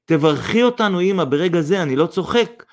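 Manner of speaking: high, urgent insistence; determined. A narrator's reading. Son asks mother's blessing, determined. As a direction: demand it with resolve. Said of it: "בנחישות אמר אדיק".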